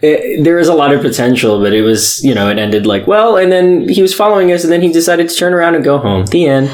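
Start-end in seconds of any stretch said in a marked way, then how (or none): 3.1-6.7 high, fast mock summary. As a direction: rattle it off like a lame ending. Mocking the story's flat ending.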